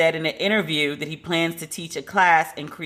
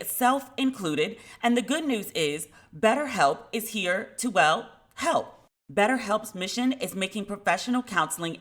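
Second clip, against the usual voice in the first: softer, higher, measured ad voice; sponsor ad read; 4 semitones higher, 5 dB quieter than usual. Real, not acted. Host offering listeners help with counseling, ad read. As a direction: pitch the service gently and clearly.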